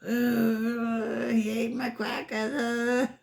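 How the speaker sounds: fake-cry it like a wronged child; mock crying. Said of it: "And she was like".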